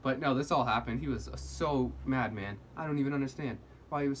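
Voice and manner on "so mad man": ordinary talk, nothing marked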